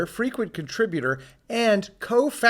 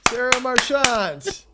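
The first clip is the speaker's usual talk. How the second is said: slow, higher drawn-out "Sarah Marchant" with a rising end; playful echo; 7 semitones higher, slower than usual. Acted. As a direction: savour the name like an announcer. Co-host echoes the guest's name.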